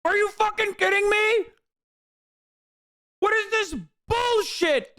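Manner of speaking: high-pitched furious ranting at the screen; angry rant. Real, not acted. Ranting at a video he hates.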